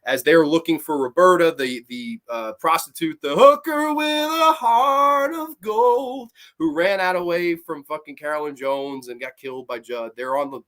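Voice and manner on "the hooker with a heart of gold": slow, high, loud drawn-out epithet; mock-grand. Reviewer introducing the prostitute character, hammed-up drawl. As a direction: stretch every word out theatrically.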